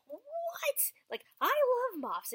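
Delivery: high whispered what, then loud and slow; shocked disbelief. Retold or imitated. Swarmed by moths at four in the morning, shocked. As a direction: gasp it, then exclaim slowly.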